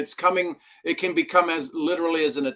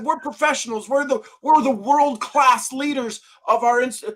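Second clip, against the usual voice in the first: mock indignant; 10 semitones higher, 4 dB louder than usual. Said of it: "I said".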